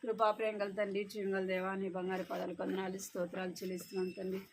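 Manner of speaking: soft, hushed prayer voice. Acted. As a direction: pray quietly with bowed head. Leads the prayer. (మెల్లని స్వరంతో ప్రార్థన)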